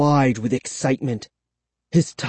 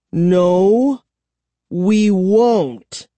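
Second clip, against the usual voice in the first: higher, louder defiant refusal; firm refusal; 5 semitones higher, 4 dB louder, much slower than usual. A narrator's reading. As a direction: stand up to him firmly. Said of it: "I told him".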